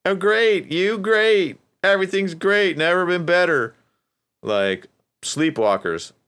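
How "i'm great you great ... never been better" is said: much higher, louder fake-upbeat denial; strained high happy act; mock forced cheer. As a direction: parody someone about to cry insisting they're great. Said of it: "they're like".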